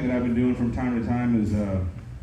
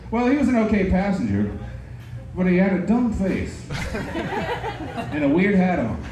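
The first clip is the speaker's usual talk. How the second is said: mock-polite verdict that slows into laughter; prim review breaking into a laugh; quoting himself; 5 semitones higher, slower than usual. Driver mocking back at his rude passenger. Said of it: "I was like".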